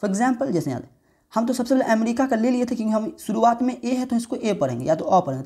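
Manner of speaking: ordinary talk, nothing marked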